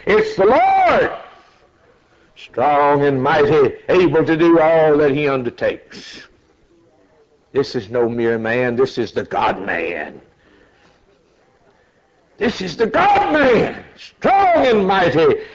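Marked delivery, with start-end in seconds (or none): 0.1-6.3 triumphant herald. Acted. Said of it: "the voice comes back and says". 12.4-15.4 high, slow exultant preaching; soaring proclamation. Real, not acted. Preacher exulting to the congregation, high and slow.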